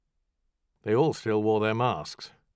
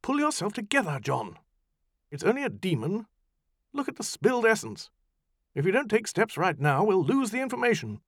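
raised, brisk and impatient woman; high, pushing scold; 13 semitones higher, 5 dB louder than usual. Acted. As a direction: hurry him along sharply. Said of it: "Farrar laid an impatient hand".

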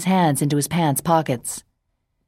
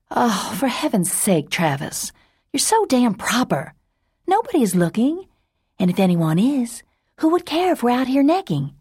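put-on higher, teasing young woman scolding; exasperated Sage; 7 semitones higher than usual. Sage scolds proper Travis, high exasperated tease. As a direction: roll your eyes at him, pitched up.

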